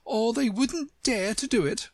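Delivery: high-pitched, indignant flutter; a flustered flower, high and agitated; agitated panting. A narrator's reading. Tiger Lily trembling with excitement to Alice, agitated. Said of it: "it panted".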